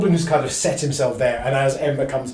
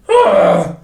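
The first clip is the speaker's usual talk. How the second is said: goblin grunt; 3 semitones higher, 7 dB louder than usual. Acted. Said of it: "looks back and goes".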